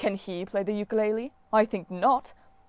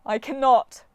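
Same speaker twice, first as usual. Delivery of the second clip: a touch of a wail, higher and louder; godly whine, lightly wailed; 5 semitones higher, 6 dB louder, slower than usual. A narrator's reading. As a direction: cry it out in mild despair. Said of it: "Apollo wailed".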